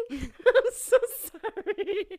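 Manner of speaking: high, loud, drawn-out through laughter; laughing mock apology. Retelling her apology to baby dad, laughing loudly. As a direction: squeal the retold apology while cracking up.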